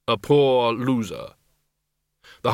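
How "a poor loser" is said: grim reaper voice. Acted. Death answers.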